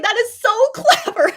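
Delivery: slow, loud, laughing through the words; laughing as she retells praising his joke; laughing praise. Retold or imitated. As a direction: gush and crack up mid-line. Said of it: "I was like".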